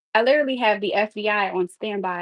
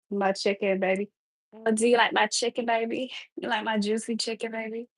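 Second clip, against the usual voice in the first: soft, sultry come-on, fading to a hush; mock seductive murmur; fake sexy cooking voice; 3 dB quieter than usual. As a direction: coo it like a seductive cooking show host. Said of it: "my fake cooking sexy voice".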